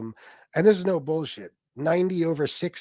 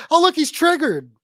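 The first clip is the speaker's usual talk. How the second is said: loud, high-pitched mock taunt; mocking imitation of gleeful accusers; 11 semitones higher, 6 dB louder than usual. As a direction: jeer it high and loud like a heckler. Mimics people accusing him.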